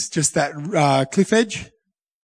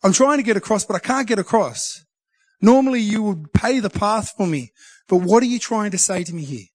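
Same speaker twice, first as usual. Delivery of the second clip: retold frustrated plea, pitched higher; 7 semitones higher than usual. Stuck at the slippery rocks, speaker pleading with God in frustration. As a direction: press God for an answer, half-exasperated. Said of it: "I actually started getting frustrated at God".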